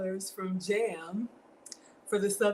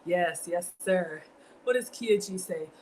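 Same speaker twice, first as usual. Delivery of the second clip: ordinary talk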